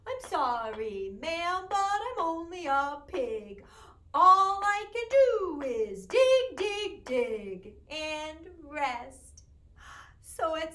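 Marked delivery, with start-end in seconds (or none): 0.1-9.4 slow, mock sorry pig, louder on dig; a pig shrugging off the blame; apologetic pig. Pig answering the farmer in the rhyme, mock apologetic. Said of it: "when I was talking like the pig".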